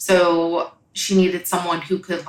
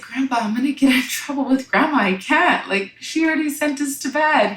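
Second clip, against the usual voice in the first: put-on higher, childlike voice, anxious to obey; worried little girl; 8 semitones higher than usual. Nine-year-old girl refusing grandpa's invitation, higher childlike worried voice. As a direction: play your nine-year-old self afraid of Grandma.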